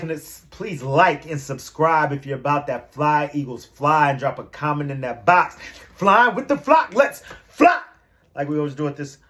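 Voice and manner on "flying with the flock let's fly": rally the flock, rising up loud; hyped catchphrase. Real, not acted. Host rallies viewers with the catchphrase, hyped and loud. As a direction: chant the catchphrase high and loud. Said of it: "channel catchphrase".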